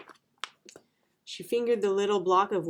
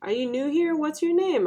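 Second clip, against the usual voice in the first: put-on higher, curious child's questions; inquisitive girl; 9 semitones higher, 3 dB louder than usual. A narrator's reading. Girl questions the new kid on the bus, inquisitive. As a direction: ask as a nosy schoolgirl.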